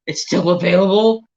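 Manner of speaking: can't believe it's still for sale; breathless excited surprise; surprised disbelief. Retold or imitated. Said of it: "I seen it today and I was like".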